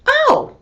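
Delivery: high sharp yelp of pain; a quick hurt yelp; mock ow. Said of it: "it wasn't like".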